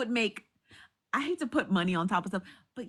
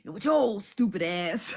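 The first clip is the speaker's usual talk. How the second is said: imitating Kev; 3 semitones lower, 3 dB louder than usual. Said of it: "how'd Kev be talking".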